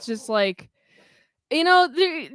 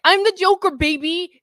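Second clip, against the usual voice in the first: higher, louder gloating Joker boast; gleeful Joker; 7 semitones higher, 4 dB louder than usual. Retold or imitated. Retelling the Joker's stair scene, a higher, louder gloating boast. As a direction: crow it like a triumphant villain.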